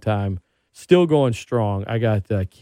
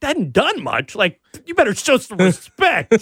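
high, laughing mock indignation; mock outrage; 11 semitones higher, 3 dB louder than usual. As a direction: defend your hero, half laughing. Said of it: "I'm like".

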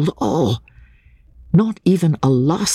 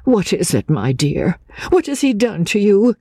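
higher, motherly questioning; concerned companion; 8 semitones higher than usual. A narrator's reading. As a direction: ask anxiously, as to a dear friend. Companion comforts the frightened woman.

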